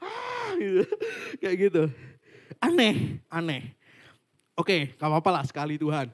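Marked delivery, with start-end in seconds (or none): 0.0-0.5 high mock wail of a prayed-over person; imitated scream. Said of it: "Malah orang teriak-teriak". 0.9-1.4 very high crying whimper; high mock wail, then a laugh; imitated cry. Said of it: "Malah orang teriak-teriak".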